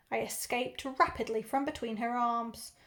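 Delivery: ordinary delivery, nothing marked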